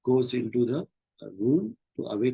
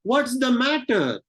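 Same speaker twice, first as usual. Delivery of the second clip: high, slow, drawn-out acted cry; alarmed question; 10 semitones higher, 4 dB louder, much slower than usual. Teacher plays Macbeth and Lennox questioning Macduff together, high alarmed cry. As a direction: call it out high, stretching every word.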